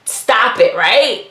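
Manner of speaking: ordinary talk, nothing marked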